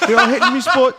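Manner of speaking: very high, loud mock Irish squeal; Irish accent. Irish woman in bed, squealed mock Irish accent. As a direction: shriek it like a hammed-up Irish woman. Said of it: "this Irish chick from Ireland".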